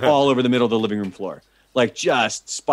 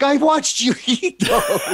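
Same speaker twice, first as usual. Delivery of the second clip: high, slow, laughing through the words; laughing buddy; much slower than usual. Retold or imitated. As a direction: giggle it out slowly. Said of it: "he's like".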